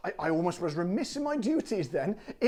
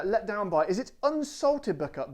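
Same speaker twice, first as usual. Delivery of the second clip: normal talking voice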